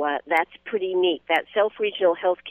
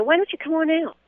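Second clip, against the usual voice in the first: high, fast and eager; excited invitation; 11 semitones higher, 3 dB louder than usual. Acted. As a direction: pitch it up like a cheerful promo. Inviting listeners out.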